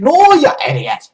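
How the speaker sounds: angry guard shouting at the culprit; furious shout. Retold or imitated. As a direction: blast the insult. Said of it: "He's like".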